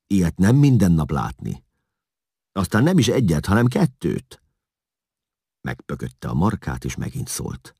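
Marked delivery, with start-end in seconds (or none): 0.1-4.4 high, loud, eager justification; making excuses. A narrator's reading. Hun justifies the gawking to Eszlasz, eagerly defensive. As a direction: argue your excuse eagerly. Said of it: "mentegetőzött a hún".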